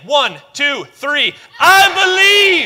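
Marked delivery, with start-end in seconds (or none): 1.6-2.7 very high, very loud, drawn-out yell; full-voice shout. Real, not acted. Church shouts at full force on the third try. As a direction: give it everything you've got. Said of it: "Let's try it again".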